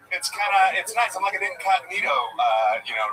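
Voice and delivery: ordinary talk, nothing marked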